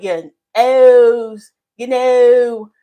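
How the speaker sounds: stretched Delaware O's, "your O's" said loud; mock the Delaware O in "your O's"; imitating Delaware O's. Shown as a demonstration. Teasing Angie, imitating her Delaware O's loudly. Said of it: "you say it like that".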